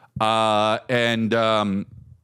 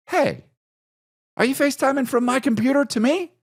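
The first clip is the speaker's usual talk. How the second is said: surprised question; faster than usual. Retold or imitated. Realizing she used his computer.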